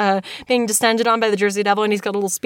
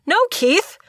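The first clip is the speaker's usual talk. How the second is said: higher, exasperated cry of "Keith"; exasperated outcry; 8 semitones higher, much slower than usual. Real, not acted. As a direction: cry out the name in disbelief.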